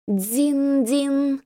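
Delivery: high ringing bell sound; bell chime imitation. A narrator's reading. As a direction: ring it out like a temple bell. Temple bell ringing in scene.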